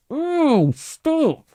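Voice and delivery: squealing high kender protest; yowled. A narrator's reading. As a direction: yowl as your legs get yanked. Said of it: "yowled Tass".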